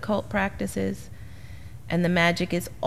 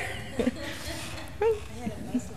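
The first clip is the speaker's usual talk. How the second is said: breathy laugh; 5 semitones higher, 11 dB quieter than usual. Real, not acted. Laugh after the brush-off.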